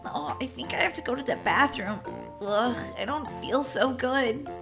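acted stomach-ache misery; queasy. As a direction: groan it weakly, feeling ill. Wife about to be sick.

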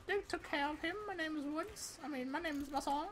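high, bouncy put-on soldier voice; Mason mode. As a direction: play a cheerful, goofy Mason picking off enemies. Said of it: "Mason, activate mode".

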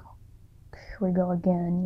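breathy resigned whisper; weary whisper. Retold or imitated. To herself as the pain returns, resigned whisper. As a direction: murmur it like you're bracing for the pain again.

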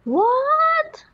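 high, loud, drawn-out cry; astonished. Real, not acted. Player reacting to the hacker's death, loud astonished cry. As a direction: shout it in sheer disbelief. Echoes the disbelief at the kill.

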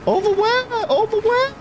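high, baffled repeated question; scared horror game streamer. Real, not acted. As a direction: squeak it out confused. Confused at companion.

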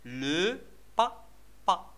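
slow "لو بابا", lø, pa, pa with gaps; split into syllables. Shown as a demonstration. Teacher sounding out the picture for children, "لو بابا" in separate syllables. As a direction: sound out "لو بابا" one beat at a time. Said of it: "لي سيلاب اللي كيتكونوا منهم".

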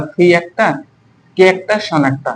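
ordinary delivery

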